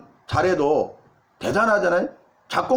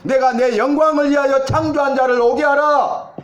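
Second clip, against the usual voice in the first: higher and louder, ringing declaration; proclaiming loudly; 6 semitones higher, 4 dB louder than usual. Acted. Pastor climaxing the sermon with God's words, loud proclamation. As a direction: boom the scripture out as God's own voice.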